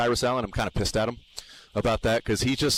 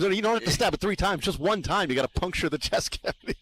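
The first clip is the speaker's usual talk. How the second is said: ordinary delivery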